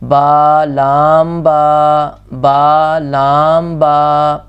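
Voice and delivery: با لام با drawn out, each letter held; slow calm reading. Shown as a demonstration. Teacher showing the calm correct reading. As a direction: read calmly and slowly. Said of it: "آہستہ سے سکون سے پڑھیں گے".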